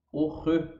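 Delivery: R as a throaty French sound with a rounded vowel; R said neither as "re" nor as "ro". Shown as a demonstration. Teacher correcting learners' R, French throaty demo. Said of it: "Não é o re".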